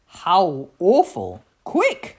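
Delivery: lament, then call out sharply; dramatic alarmed mum. A narrator's reading. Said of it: "called Mum".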